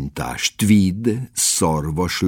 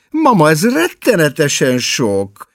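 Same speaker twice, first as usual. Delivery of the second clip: a higher, slightly louder protest; exclaiming; 9 semitones higher, 5 dB louder than usual. A narrator's reading. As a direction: cry out at the amount of food. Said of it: "kiáltott fel Margó". (felkiáltva)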